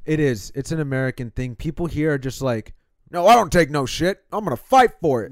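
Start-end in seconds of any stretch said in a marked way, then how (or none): 3.1-5.3 loud mock defiance; mock tough guy. Mocking Americans who fight, loud tough-guy voice.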